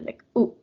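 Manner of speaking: ooh cut off abruptly; clipped ooh. Shown as a demonstration. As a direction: stop the ooh short. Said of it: "you stop it".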